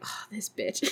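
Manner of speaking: unvoiced whisper under her breath; whispered insult. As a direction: mutter it with a sneer, voiceless. [name] muttering at Patty.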